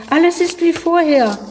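put-on high dismayed boy's cry; ausgerufen. A narrator's reading. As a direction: still crying out in dismay. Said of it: "rief der Junge aus". (hoher enttäuschter Ausruf)

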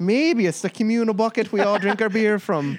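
bad Irish-Scottish pub accent; mock Irish accent. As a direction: play a cheeky Irishman at a 1920s bar. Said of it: "bad Irish slash Scottish accents".

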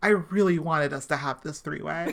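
low, hushed whisper for comic effect; soft, voiceless aside in character; mock-confiding whisper. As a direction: whisper it like a private confession. Joke line in a character's voice.

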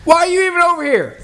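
high-pitched, mildly irritated retort; exasperated comeback. Retold or imitated. Retells snapping back at nagging coworker.

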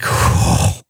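whispered, stunned whoa; a shocked, whispered whoa; breathless whoa. Retold or imitated. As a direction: let it out like the wind was knocked out of you. Shocked by the dad's question.

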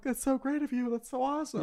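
high, soft cooing praise; gushing stranger. Acted. Stranger congratulating someone on a stepbrother, gushing.

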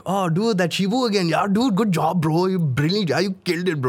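put-on excited, high Indian accent voice. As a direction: hype it up in an Indian accent, bro. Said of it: "anytime I did an Indian accent".

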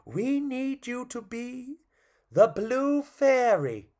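high, slow, drawn-out toy voices; pleading toys. Acted. Toys asking the grumpy clown for help, high and drawn out. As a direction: draw it out high, coaxing. Toys' chorus voiced in story.

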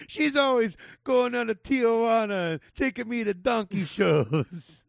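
high, loud mock Italian-American comic; Sebastian Maniscalco. Co-host joins the bit about the comic's mom, high hammed-up impersonation. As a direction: pile on the hammy stand-up bragging about mom. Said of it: "and he's like".

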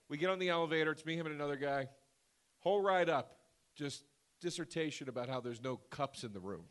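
usual speaking voice

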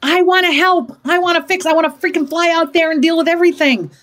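high, eager outburst; pent-up urge. Acted. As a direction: let the bottled-up urge to rescue burst out. Voices her suppressed urge to fix.

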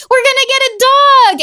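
high, elated cry; excited. Retold or imitated. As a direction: re-enact a winner's joyful announcement. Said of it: "it was, like, so exciting".

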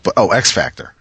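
ordinary talk

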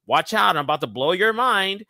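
normal talking voice

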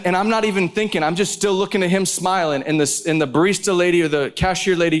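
normal talking voice

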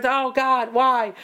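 high, anguished mock yell; hammed-up cry of a frustrated viewer; yelling at the screen. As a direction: wail it up high at the movie. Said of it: "you might be yelling at the screen".

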